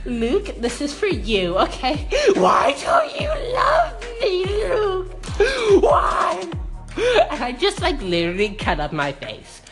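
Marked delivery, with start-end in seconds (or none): 2.4-6.4 high, loud, slow mock-crying wail; hammed-up sobbing plea to an ex; melodramatic wail. Vlogger reenacting her video begging her ex Luke, hammed-up high wail. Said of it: "And I was just like".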